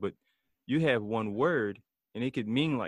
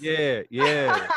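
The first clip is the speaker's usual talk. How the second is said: laughing; 3 semitones higher, 7 dB louder than usual. Real, not acted. Laughing at the moaning story.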